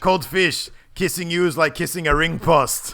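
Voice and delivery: high, loud wrestler insult; Rusev impression. Wrestler finishing his insult, loud scornful impression. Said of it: "and he says".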